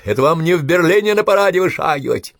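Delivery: high, loud taunt; angry shout. A narrator's reading. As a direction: yell it spitefully at the enemy. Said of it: "зло прокричал Косенко".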